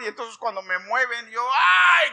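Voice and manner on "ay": loud, high, drawn-out "ay"; re-enacted high pain shout; yell of pain. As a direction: cry out as if moved while hurting. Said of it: "Grité todavía".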